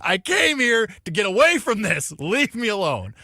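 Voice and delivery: high, loud mock rant, stretching the first words; exasperated yelling. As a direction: re-enact yelling at an annoying stranger. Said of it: "Why were you yelling at that guy".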